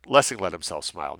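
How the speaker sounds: plain reading voice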